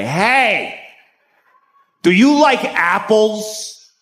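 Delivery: high, drawn-out mock taunt. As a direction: call out to bait him, stretching the words. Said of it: "he decides to taunt him".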